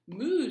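loud high mooing; cow moo. Acted. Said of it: "said Cow".

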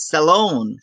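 salon stressed on LON, American style; sa-LON, model American salon; second-syllable stress. Shown as a demonstration. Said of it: "American ইংলিশে এই যে second syllable".

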